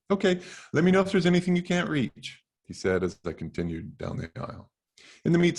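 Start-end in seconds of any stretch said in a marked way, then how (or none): 0.1-2.4 high, bright-eager store clerk voice, quick; eager teen clerk. Acted. Teen clerk greeting a man in a wheelchair, high and fast. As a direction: chirp it out like an overhelpful teenager.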